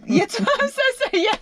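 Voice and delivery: high, laughing apology; real giggling fit mid-sentence; helpless laughter. Real, not acted. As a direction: squeeze the words out through laughter. Overcome by laughter after mocking accent.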